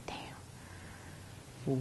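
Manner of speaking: barely voiced whisper; hushed, whispered aside to self; whispered reaction. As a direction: whisper it under your breath. Retelling shocked reaction to bad grade.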